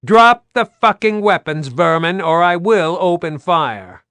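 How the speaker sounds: high, loud order through a bullhorn; booming megaphone command to drop the weapons. Acted. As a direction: bark it like a loudhailer warning. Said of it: "Then the bullhorn boomed".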